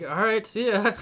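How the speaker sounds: high, loud mocking "see ya"; flippant goodbye. Kids brushing off the angry driver, high mocking farewell. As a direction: toss it off cheekily as you leave.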